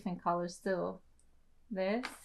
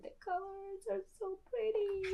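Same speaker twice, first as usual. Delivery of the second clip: much higher, softer swoon over the colors; delighted gush; 12 semitones higher, 6 dB quieter than usual. Real, not acted. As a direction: coo over them, enchanted. Gushing over nail colors.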